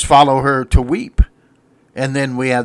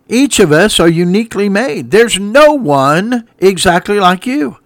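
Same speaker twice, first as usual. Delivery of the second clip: louder and higher, preaching hard from the pulpit; full-force preaching; 5 semitones higher, 4 dB louder than usual. Acted. Preacher urging his radio listeners, at full force. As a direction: drive each line home like a revival preacher.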